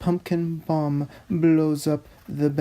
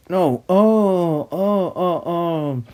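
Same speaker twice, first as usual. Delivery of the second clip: loud, high cries of dismay; 3 semitones higher, 5 dB louder, slower than usual. Real, not acted. Reader catching a typo in the script, loud dismayed oh. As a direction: groan out the mistake. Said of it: "It's a typo".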